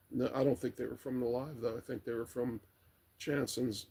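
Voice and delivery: ordinary delivery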